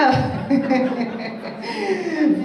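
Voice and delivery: a long laugh that tails downward; falling laugh. Real, not acted. Trainer laughing on after her joke, falling laugh. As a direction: let the laugh roll down.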